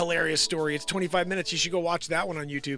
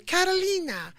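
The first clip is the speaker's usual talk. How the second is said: high falsetto introduction; mock scammer woman; 12 semitones higher, 3 dB louder than usual. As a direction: give the name in her put-on high voice. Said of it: "She goes".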